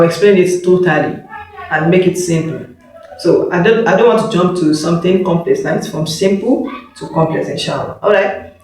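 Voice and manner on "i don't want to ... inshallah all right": ordinary delivery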